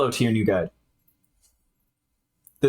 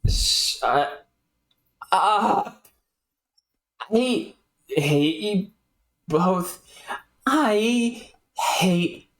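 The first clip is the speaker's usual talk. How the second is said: slow, dragged, halting hatred; understated self-loathing; self-hating rant; 6 semitones higher, much slower than usual. Acted. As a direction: drag the words out in misery.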